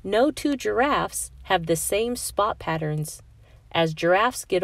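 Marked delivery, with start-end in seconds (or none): none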